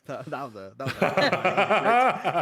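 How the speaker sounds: laughing through the words. Real, not acted. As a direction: burst out laughing mid-sentence. Laughing at memory.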